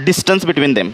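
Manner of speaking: DISTANCE BETWEEN THEM in one low, falling phrase; dictation phrase. Shown as a demonstration. Teacher dictates, first as whole phrase.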